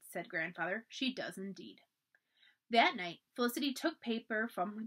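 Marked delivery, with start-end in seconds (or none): 0.9-1.8 quiet echo. A narrator's reading. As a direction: murmur it again to himself.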